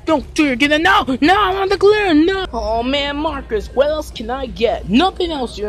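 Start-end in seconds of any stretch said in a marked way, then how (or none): 0.0-1.0 scolding. Acted. Parent scolding child in store. 1.2-2.5 put-on high, loud child's protest; a kid throwing a tantrum over glitter; whiny kid.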